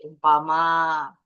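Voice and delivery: normal talking voice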